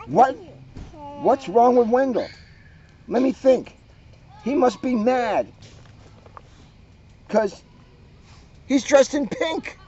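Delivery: high, mocking witch voice, sing-song; taunting witch. Witch jeering at Wendell, high mocking witch voice. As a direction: jeer at the boy like a cackling witch.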